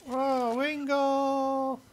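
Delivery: high, held, drawn-out call. Pretend. Player spots Wingull, high drawn-out call. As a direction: sing out the name on one high note.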